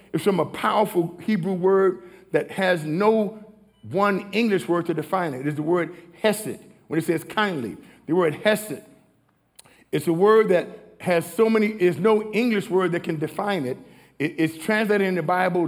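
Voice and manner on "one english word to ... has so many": ordinary talk, nothing marked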